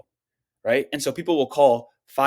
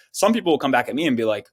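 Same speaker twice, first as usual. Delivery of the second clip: ordinary talk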